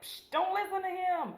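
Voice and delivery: high-pitched mock scoff; hammed-up dismissive robber; mock dismissal. Acts out robbers dismissing the scientist.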